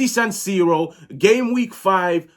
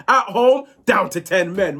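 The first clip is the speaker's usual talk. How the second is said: ordinary delivery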